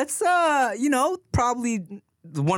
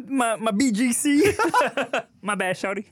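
Kermit impression; 6 semitones higher than usual. Quoting Kermit meme.

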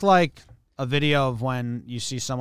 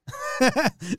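high burst of laughter. Real, not acted. Laughing at the clip.

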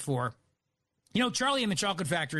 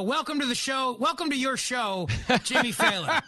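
loud, high, gleeful welcome; excited welcome; 6 semitones higher, 3 dB louder than usual. Real, not acted. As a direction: hype up the guest. Hyped welcome of guest.

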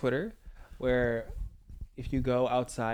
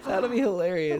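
laughing; 6 semitones higher, 6 dB louder than usual. Real, not acted. Laughing at filter idea.

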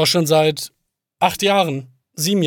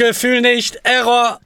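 robotic error message; 8 semitones higher, 4 dB louder, slower than usual. Pretend. Joins the malfunctioning AI bit.

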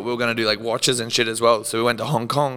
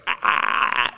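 voiceless, broken "ah" noises; imitated stuttering cry; slower than usual. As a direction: imitate yourself making choppy, repeated open-mouthed noises. Imitates own noise in story.

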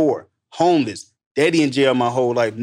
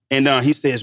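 ordinary talk

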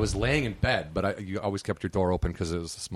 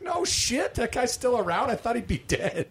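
high, amazed mock voice; stunned friend; 13 semitones higher, slower than usual. Friend hearing Bert is alive, amazed.

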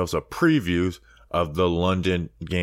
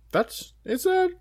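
high squeaky voice. Pretend. Unsure about an Italian name.

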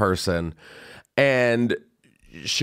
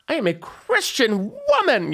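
much higher, prissy pearl-clutching; prim church lady; 13 semitones higher than usual. Pretend. Mock prudish protest.

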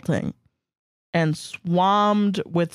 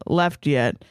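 ordinary delivery

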